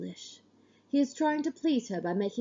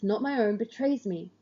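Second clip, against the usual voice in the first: ordinary delivery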